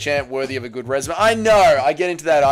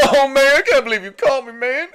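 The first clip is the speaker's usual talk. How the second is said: higher, laughing caught-out DC; sheepish mock laugh, pitched up; DC impression; 10 semitones higher, 3 dB louder than usual. Streamer as DC caught in the Tim Tam packet, high mock laugh. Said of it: "Impression of Derek Lewis and D C".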